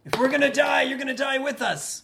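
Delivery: loud, high in-character battle cry; hammed-up defiant yell; desperate guard's cry. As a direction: shout it as a doomed foe charging. GM voices doomed guard attacking.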